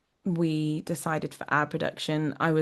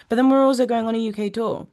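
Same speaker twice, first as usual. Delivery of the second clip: usual speaking voice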